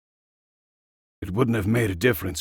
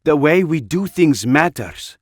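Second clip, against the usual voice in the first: louder and higher, insisting hard; firm insistence; 7 semitones higher, 7 dB louder than usual. Acted. Anna insisting to Jack that methods matter, loud and firm. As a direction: lay down the principle forcefully.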